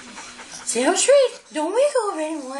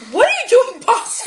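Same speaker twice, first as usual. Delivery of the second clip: high, loud laughing call; laughing shout; 8 semitones higher, 7 dB louder than usual. Real, not acted. Owner laughing and calling out to the dog.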